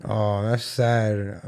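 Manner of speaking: slow, low, soft sympathy; sad. Real, not acted. Realizing the kids in the footage are dead, sad. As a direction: let it sink in, drawn out and subdued. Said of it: "now I'm sad".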